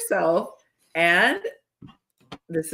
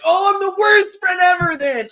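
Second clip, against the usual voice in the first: much higher, louder wounded wail; mock self-pity; 13 semitones higher, 7 dB louder than usual. Friend mocking a guilt-trip reply, loud self-pitying wail. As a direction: overplay the hurt friend, wailing. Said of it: "and you were just like".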